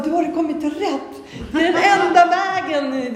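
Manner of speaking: high, loud, overjoyed declaration; excited exclamation. Retold or imitated. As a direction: burst out with joy, pitched high. Excitedly telling Johan about God.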